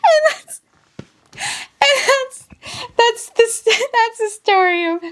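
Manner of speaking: high, breaking into laughter; giggling sign-off; laughing wrap-up. Real, not acted. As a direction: try to finish through laughter. Laughing wind-up.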